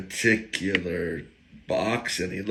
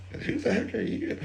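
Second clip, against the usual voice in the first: high, softer mock demand; imagined challenge, toned down; 14 semitones higher, 5 dB quieter, faster than usual. Church elder minding his language, high soft mock challenge. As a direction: squeeze the question up high, holding the volume back. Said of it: "'Cause he's in a church".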